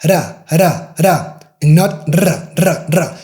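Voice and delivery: "ra" with one tap then "ra" rolled; tap versus trill. Shown as a demonstration. Teacher contrasting the two R sounds. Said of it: "you just hit once".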